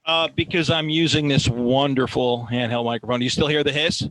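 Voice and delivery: loud handheld mic with hiss; boosted mic level; uneven auto gain. Real, not acted. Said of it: "hissing in the audio when you don't talk".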